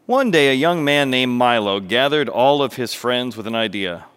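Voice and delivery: animated storyteller, projected and expressive; story narration. A narrator's reading. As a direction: tell it like a lively children's tale. Narrates a parable.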